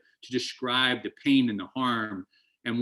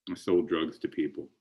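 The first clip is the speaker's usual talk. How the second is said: normal talking voice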